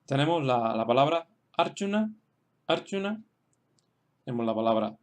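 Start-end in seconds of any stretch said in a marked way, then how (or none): 1.6-3.2 "Arjuna" accented on AR; teacher's careful "Arjuna", weight on AR; stress on first syllable. Shown as a demonstration. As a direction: say "Arjuna" as a model, stressing the opening AR. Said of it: "es la primera sílaba".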